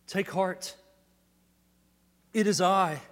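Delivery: loud, high proclamation; preaching at full force. Acted. Preacher voicing Jesus to the congregation, loud fervent proclamation. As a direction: ring out the words like good news.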